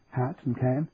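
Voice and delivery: plain reading voice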